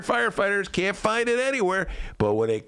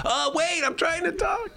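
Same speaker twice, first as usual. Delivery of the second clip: mock exasperation; 12 semitones higher than usual. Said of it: "Jesse's like".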